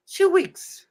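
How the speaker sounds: two weeks squeaked very high; two weeks shot up high in disbelief; high emphatic squeak. Real, not acted. Stressing how short the wait is.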